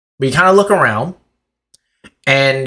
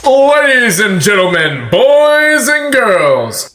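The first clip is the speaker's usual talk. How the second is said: ringmaster woman; 10 semitones higher, 4 dB louder, slower than usual. A narrator's reading. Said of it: "a woman speaks".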